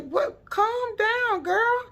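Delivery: put-on high, incredulous calm down girl; a cousin taken aback, voiced high and incredulous; mock taken aback. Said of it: "T T was looking at her like".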